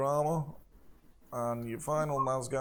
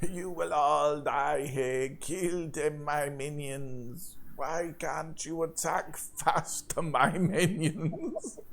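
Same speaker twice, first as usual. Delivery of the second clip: very slow, drawn-out villain voice; hammed-up monster lord, stretching every phrase; skeleton monster; 2 semitones higher, slower than usual. Skeleton thing berating its minions, slow monster voice. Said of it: "The skeleton thing".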